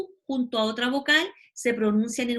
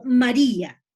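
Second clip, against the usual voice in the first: "María" said louder as an example; emphatic example; 8 dB louder, slower than usual. Shown as a demonstration. Teacher stresses an example word for students. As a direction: call out "María" firmly to show the stressed I. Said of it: "es decir".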